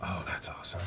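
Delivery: soft reverent awe; hushed awe. Real, not acted. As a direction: breathe it out in quiet wonder. Hushed admiration of the book.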